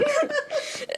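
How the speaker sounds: high squealing laugh; burst of high laughter. Real, not acted. Laughs at the post.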